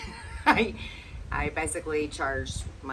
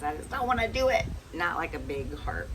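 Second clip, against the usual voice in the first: ordinary talk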